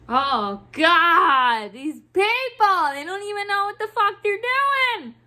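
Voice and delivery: loud, high-pitched venting; exasperated outburst. Real, not acted. Worker venting about clueless people, exasperated outburst. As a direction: blow up in frustration, voice climbing and staying up.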